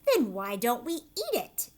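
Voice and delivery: loud, doubting fat aunt voice; scoffing aunt. Acted. Aunt Sponge doubting Spiker about the peach. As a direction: sneer at her sister's claim.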